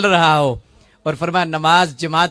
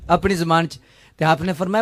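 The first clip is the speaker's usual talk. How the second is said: ordinary talk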